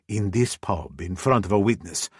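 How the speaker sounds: plain reading voice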